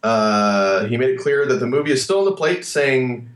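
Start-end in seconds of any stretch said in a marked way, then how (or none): none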